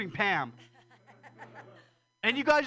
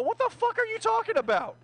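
incredulous outburst; 13 semitones higher than usual. Real, not acted. Disbelieving outburst.

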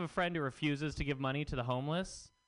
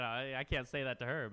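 ordinary talk, nothing marked

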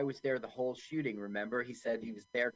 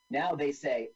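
ordinary talk, nothing marked

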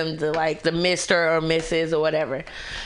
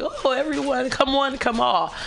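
higher, sing-out mock invitation; mock open welcome; 6 semitones higher than usual. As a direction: play an over-welcoming host. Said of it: "I just don't just like".

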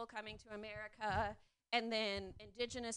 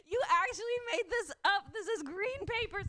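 high, loud, laughing-crying disbelief; hammed-up incredulous wail; mock disbelief; 9 semitones higher, 5 dB louder than usual. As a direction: act it out cracking up in outrage. Natives shocked by paper money.

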